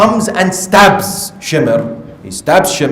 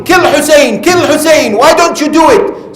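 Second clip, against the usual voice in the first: high, loud battlefield shout; 10 semitones higher, 4 dB louder than usual. Retold or imitated. Shimr urging soldiers to kill Hussein, shouting. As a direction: urge them on in a raised, high-pitched shout. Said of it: "he started shouting".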